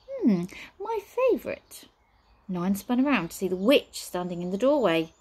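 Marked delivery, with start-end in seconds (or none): 0.1-1.8 high, slow lava-like ooze. A narrator's reading. Witch relishes the offer of tea, lava-like voice. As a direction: draw it out, high and languid. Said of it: "said a lava-like voice".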